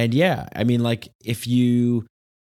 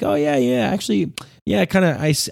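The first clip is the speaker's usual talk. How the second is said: much higher, caught-out stammering; imitating a borrower fumbling about the unread book; flustered excuse; 11 semitones higher than usual. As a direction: squirm for an excuse. Flustered borrower caught not reading.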